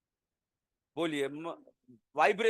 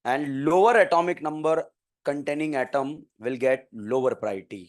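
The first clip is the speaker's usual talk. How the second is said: slow, low dictation pace for note-taking; 8 semitones lower, much slower than usual. Shown as a demonstration. Teacher dictating a rule to students, slow and measured. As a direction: spell it out slowly so students can copy.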